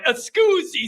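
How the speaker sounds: a playful fake Italian scusi; showing off one Italian word; mock Italian. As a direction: toss off scusi like a proud tourist. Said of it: "I could do that one".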